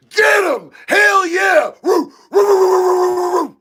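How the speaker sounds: high, loud whooping cheers; amped-up cheering. Real, not acted. Streamer cheering the Irish rugby catch, loud high whoops. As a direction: hype it up like a fan in the stands. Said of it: "Sorry, I get amped".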